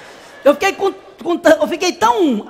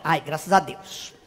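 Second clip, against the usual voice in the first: low, soft sigh of relief; muted relief; 13 semitones lower, 12 dB quieter, slower than usual. Retold or imitated. Client relieved she may keep her panties, muted relief. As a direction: drop it low and drag it out softly.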